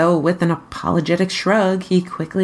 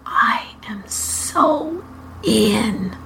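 soundless whispered words; just shape the words, no voice; mouthed; 6 semitones higher, much slower than usual. A narrator's reading. Moist mouthing to Barney behind Robin's back: whispered.

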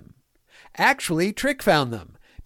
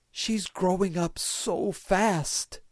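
fond; 2 semitones higher, 5 dB quieter, slower than usual. Acted. Fond father figure.

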